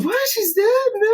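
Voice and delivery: sudden high-pitched disbelief; shocked. Retold or imitated. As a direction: blurt it out in shock. Retold shock at a death.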